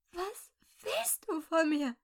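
high, slow, quavering plea; trembling, frightened voice. A narrator's reading. Crying victim facing the revolver, trembling high plea. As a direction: force it out haltingly through terror. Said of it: "Ihre Stimme zitterte so sehr".

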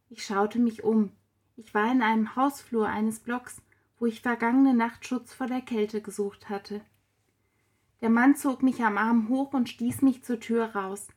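usual speaking voice

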